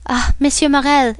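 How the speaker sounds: put-on young seaman moved to tears; grateful exclamation. A narrator's reading. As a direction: cry out your thanks. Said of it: "exclaimed the young seaman".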